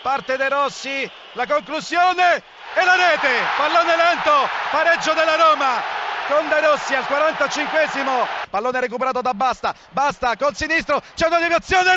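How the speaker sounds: normal talking voice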